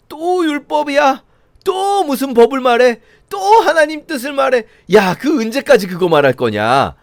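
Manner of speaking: high, mocking exasperation; sarcastic whine. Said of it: "그렇게 말하면서 비꼬고 있는 거예요".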